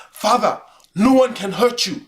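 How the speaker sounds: put-on light African accent, reverent. Streamer doing his African accent, lightly. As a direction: lightly voice an African elder praising. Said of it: "a African accent".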